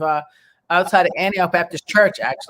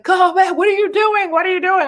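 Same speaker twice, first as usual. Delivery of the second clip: high, urgent pleas to come back; frantic crowd shouting back; 12 semitones higher than usual. Retold or imitated. Onlookers urging the runner to come back, high and alarmed. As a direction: call out in alarm as if they saw someone running off. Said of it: "everybody's like".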